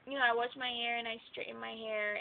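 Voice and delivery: normal talking voice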